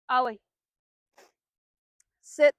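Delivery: one loud, sharp word to the dog; firm command. Real, not acted. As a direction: bark the order so the dog obeys. Commanding dog during training.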